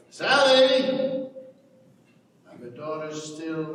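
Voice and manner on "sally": loud high call across the yard. Acted. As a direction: shout for her from the doorway. Father calling his daughter.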